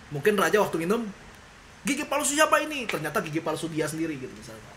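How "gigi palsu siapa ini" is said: startled king. Retold or imitated. King finds teeth in drink.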